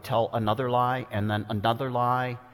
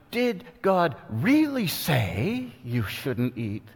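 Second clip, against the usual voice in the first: slow, sly serpent voice, pitched up; devil tempting Eve; 4 semitones higher, slower than usual. Acted. The devil whispering doubt to Eve, slow sly tempter voice. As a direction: coax each word like the tempter questioning God. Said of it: "He lied to Adam and Eve".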